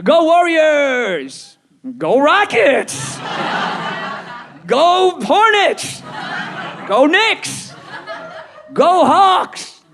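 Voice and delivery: high, drawn-out team chant; fan cheer. Acted. Fan cheering each new team.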